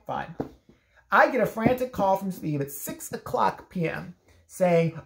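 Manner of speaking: usual speaking voice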